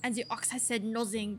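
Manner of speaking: suspicious farmer with an attempted French accent; hammy French-accented farmer. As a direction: confide it in a rough French accent. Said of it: "is this a French accent?".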